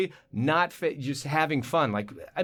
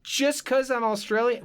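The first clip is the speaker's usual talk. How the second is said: put-on Australian accent; 10 semitones higher than usual. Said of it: "I'm doing the Australian thing".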